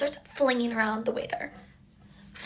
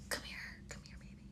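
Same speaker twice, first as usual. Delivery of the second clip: breathy come-on whispered low and soft; creepy whisper; 21 dB quieter, much faster than usual. Retold or imitated. Date pulling her close at the restaurant, sleazy whisper. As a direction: lure her in with a hushed, sleazy murmur.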